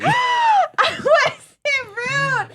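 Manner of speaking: laughing shout of disbelief. Real, not acted. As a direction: blurt it through a laugh. Laughing protest.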